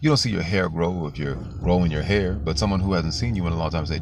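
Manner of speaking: usual speaking voice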